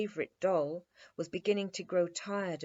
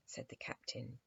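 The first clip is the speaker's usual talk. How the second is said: ordinary delivery, nothing marked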